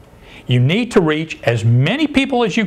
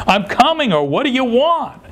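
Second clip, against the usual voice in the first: high-pitched mock holler; retold scream back; 8 semitones higher than usual. Grandfather answering his yelling grandson, retold mock scream. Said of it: "I had to scream back at him".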